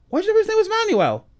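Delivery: high, loud disbelieving question; retold shocked disbelief; incredulous question. Retold confused outburst.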